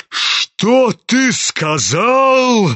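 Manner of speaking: high, slow, menacing roar; thundering general. A narrator's reading. General stepping toward his son, thundering. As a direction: boom it out as a threat. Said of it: "загремел генерал".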